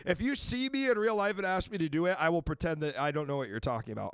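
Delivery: ordinary talk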